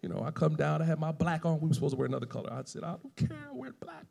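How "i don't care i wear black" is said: high, soft petulant retort; imitated stubbornness, pitched way up; mock defiance. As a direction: act out a squeaky, hushed protest. Retells defiant refusal over funeral colour.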